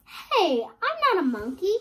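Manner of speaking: ordinary delivery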